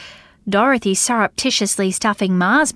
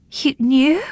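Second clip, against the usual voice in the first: a hoarse, higher startled question; 5 semitones higher than usual. Acted. Bella startled into speaking to Dorothy, hoarse. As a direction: force it out hoarsely. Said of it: "she said hoarsely".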